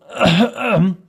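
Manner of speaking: meaningful "ahem"; deliberate, knowing cough; pointed throat-clearing. Acted. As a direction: clear the throat as a sly hint. Pointed hint at Sir Handel.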